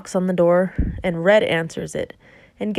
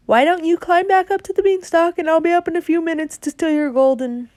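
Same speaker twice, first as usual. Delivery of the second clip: high, loud sugary coaxing; mock sweet persuading; 10 semitones higher, 4 dB louder than usual. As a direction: wheedle him like a con man, pitched up. Jack coaxes the giant away.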